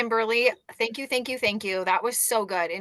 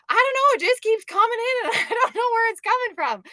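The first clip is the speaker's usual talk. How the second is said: much higher, louder, laughing disbelief; giddy bewildered quote; 13 semitones higher, 6 dB louder than usual. Retold or imitated. Upline quoting the new leader's giddy disbelief. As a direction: play her as giddy and baffled. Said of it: "She's like".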